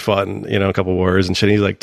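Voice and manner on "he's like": ordinary delivery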